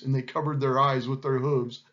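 plain reading voice

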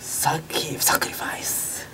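breathy whisper of fake Ugandan; whispered gibberish. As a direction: whisper the made-up words. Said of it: "それっぽいウガンダ語で".

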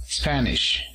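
"Spanish" as SPAN-ish, falling after SPAN; stress on first syllable. Shown as a demonstration. Teacher models the word for español, "Spanish" stressed on first syllable. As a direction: hit the opening syllable of "Spanish". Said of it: "primera sílaba".

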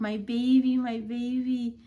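calling to the newborn, half asleep and overjoyed; drowsy tender murmur. Retold or imitated. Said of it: "I guess I kept saying".

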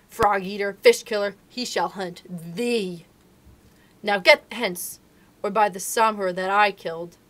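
loud, fierce, ending in a huge shout; furious she-wolf. Acted. Mother wolf driving the tiger from her cubs, furious roar. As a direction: drive the tiger out of the cave.

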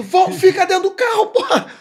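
high, loud shouted order; yelling. Retold or imitated. Husband yelling at his wife to stay in the car, loud shout. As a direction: yell at her to stay in the car. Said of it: "E eu gritando".

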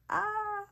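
high, drawn-out "ah" of excitement; excited squeal. Real, not acted. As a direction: let out a small thrilled squeal. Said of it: "like, really excited".

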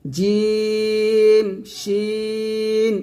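জিম, শিন held long and slow; drawn-out letter names. Shown as a demonstration. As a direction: stretch জিম and শিন evenly. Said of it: "জিহ্বার মধ্যখান". (জিম শিন টেনে উচ্চারণ)

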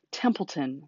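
ordinary delivery, nothing marked

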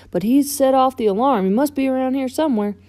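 urgent and excited; excited tow truck character. Acted. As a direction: hurry it out like a hot lead. Voices Mater in story.

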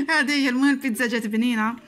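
laughing through the pizza line. Real, not acted. Vlogger laughs to viewers about the pizza, laughing. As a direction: laugh it out, amused. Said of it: "laugh heard".

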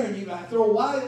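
ordinary talk

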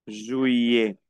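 juillet with LL drawn out as an I; double L as I. Shown as a demonstration. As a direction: glide through the LL like an I, silent T. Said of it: "las dos L como una I". (juillet, las dos L como I)

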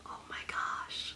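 barely audible whisper; hushed disbelief. Real, not acted. Giants fan waiting on the next pick, whispering. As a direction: whisper it slowly to yourself.

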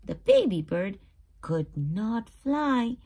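ordinary delivery

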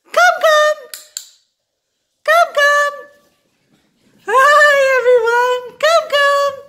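very high, loud double call of come; high-pitched call. Shown as a demonstration. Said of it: "in a high-pitched voice like this".

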